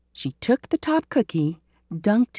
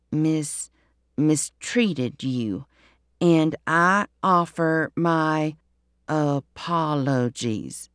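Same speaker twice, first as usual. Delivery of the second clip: slow, stumbling read-aloud; slow halting reading; 3 semitones lower, slower than usual. Acted. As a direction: read it slowly, stopping at long words. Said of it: "read slowly, pausing at the words".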